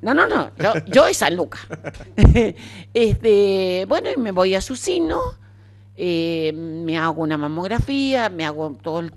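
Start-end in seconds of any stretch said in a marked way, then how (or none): 1.7-2.9 laugh. Real, not acted. Laughing at her own joke.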